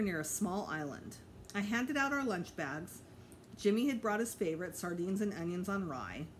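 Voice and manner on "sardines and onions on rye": ordinary delivery, nothing marked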